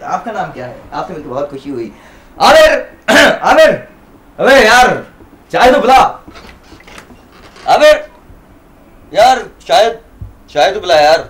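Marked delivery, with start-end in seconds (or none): 2.4-11.2 calling out. Acted. Shahid calling loudly to Aamir in the flat for tea. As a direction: shout for Aamir to bring tea.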